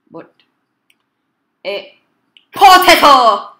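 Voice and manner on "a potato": very loud, high, drawn-out yell; bellowed stronger potato. Real, not acted. Player making a stronger potato for the game, bellowing. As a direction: belt the word slowly at full power.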